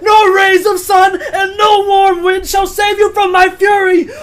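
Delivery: loud, high, slow declamation; hammed-up wrathful warrior shouting; roaring battle speech. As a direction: thunder it out like a raging storm. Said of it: "letting out a roar".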